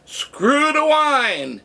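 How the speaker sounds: imitated customer's rallying holler; retold call to the room. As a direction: call it long and high to those inside. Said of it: "turn to the people left inside and go".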